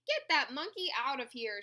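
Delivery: understated cry of outrage; mild storybook yell. A narrator's reading. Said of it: "she yelled".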